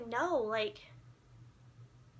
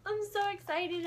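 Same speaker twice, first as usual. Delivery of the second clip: high-pitched fangirl gush; happy excitement; 8 semitones higher, 4 dB louder than usual. Retold or imitated. Fan at the soundboard, high happy excitement. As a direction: gush it high, right after a squeal. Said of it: "I'm just so happy".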